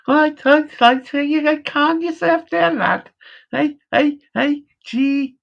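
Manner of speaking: hammed-up Liverpool accent telling a lad to calm down; Scouser accent. Scouser telling a lad to calm himself down, in a hammed-up Scouse accent. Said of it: "I can't beat a Scouser accent".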